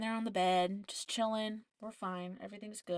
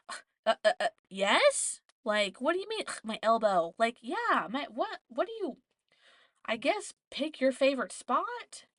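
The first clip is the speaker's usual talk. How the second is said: higher, stammering indignant disbelief; stammer, then climb into an exasperated questioning retort; baffled, mock-offended comeback; incredulous; 4 semitones higher, 5 dB louder than usual. Incredulous inner retort to doubting nurse.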